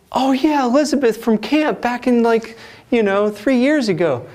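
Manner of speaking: high, loud, excited recall; light up as the memory hits; mock-excited twin remembering camp; excited recognition.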